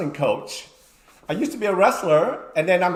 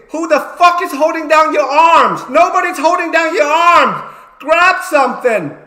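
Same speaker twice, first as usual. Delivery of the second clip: much higher, louder yelling at the wrestler; retold screaming; 10 semitones higher, 8 dB louder than usual. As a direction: scream at him to grab something. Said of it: "I'm screaming".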